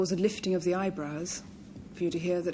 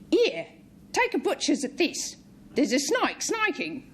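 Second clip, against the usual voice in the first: higher, louder Cockney street voice; Cockney accent; 8 semitones higher, 3 dB louder than usual. Retold or imitated. Panellist mimicking a Cockney bloke spotting a snake, in Cockney accent. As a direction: call it out like a London market trader. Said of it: "In the Cockney dialect".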